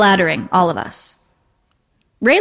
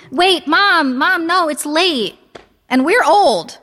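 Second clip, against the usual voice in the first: high-pitched, whiny objection; pleading protest; 8 semitones higher, much slower than usual. A narrator's reading. Daughter objects to mother's dancing plan, pleading.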